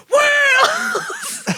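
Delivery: very high, drawn-out yell; long mock shriek; imitated dad scream. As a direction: scream it like a grown man at a kid. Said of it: "someone's dad is screaming at you".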